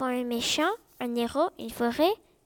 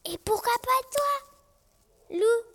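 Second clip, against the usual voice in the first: malicieux et aigu; 9 semitones higher, slower than usual. A narrator's reading. Lapin propose Loup comme méchant.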